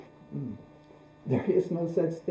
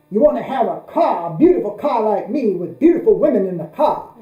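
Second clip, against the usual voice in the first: higher, louder hammed-up preacher pitch; showy preacher; 5 semitones higher, 9 dB louder than usual. Flashy Southern preacher working his congregation, hammed-up and loud. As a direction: belt it like a flashy preacher passing the plate. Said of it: "in his sermon he would say".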